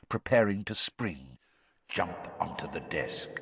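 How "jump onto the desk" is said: low, soft hypnotic order; distant echoing voice. A narrator's reading. Curse repeating its order in the boy's mind, hushed. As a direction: intone it softly from deep inside his head. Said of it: "Moody's voice echoing".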